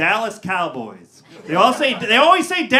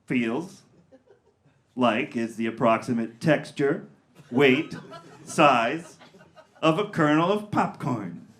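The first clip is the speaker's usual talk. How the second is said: ordinary delivery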